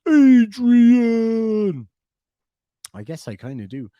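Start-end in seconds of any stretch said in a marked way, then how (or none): 0.1-1.9 high, loud bellowed Adrian! in Rocky's voice; Rocky yelling Adrian. Shown as a demonstration. Answering a viewer, a Rocky-style yelled Adrian!. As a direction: holler Adrian! like Rocky calling for her. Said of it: "you say Adrian like Rocky".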